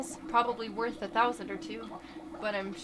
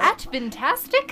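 adventastic said again, much louder and rising; louder retry; 8 dB louder than usual. Shown as a demonstration. To a puzzled friend, a louder adventastic. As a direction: spell out adventastic loudly for the friend who asked what.

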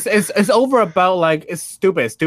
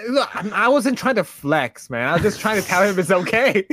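normal talking voice

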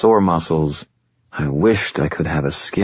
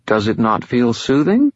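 higher, silky voice of an ancient fallen angel, calm and superior; fallen angel Lashiel; 7 semitones higher than usual. Acted. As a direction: speak as a patient otherworldly being, a little above it all. Lashiel speaks.

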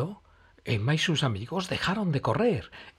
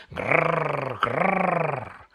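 mock saw growl, a bit louder; chainsaw growl; 4 semitones higher, 4 dB louder than usual. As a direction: rev it like a chainsaw. Chainsaw noise again.

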